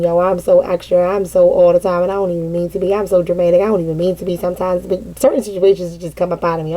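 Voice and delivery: ordinary delivery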